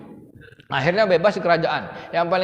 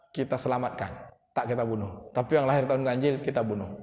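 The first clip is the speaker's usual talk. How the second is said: hushed scheming; 6 semitones lower, 6 dB quieter than usual. Acted. Haman plots with the Pharaoh.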